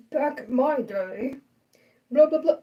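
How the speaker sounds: put-on slow elderly voice; old person voice. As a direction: play a grumbling old-timer. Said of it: "like, my old person voice".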